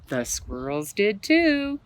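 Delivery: usual speaking voice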